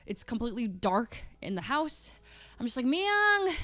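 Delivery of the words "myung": high, drawn-out mock call; imitated yelling. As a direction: call out for a housemate in another room. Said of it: "I'm, like, yelling for her".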